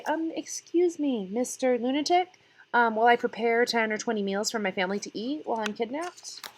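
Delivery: a hint of a prim mother's voice; mock polite kidnapped mother. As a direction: play the mother asking the lunatic nicely. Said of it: "Would she have been able to say".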